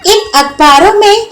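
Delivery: put-on high, puzzled young Vimla voice; naive young woman. Young wife asks why everyone laughs, puzzled high voice.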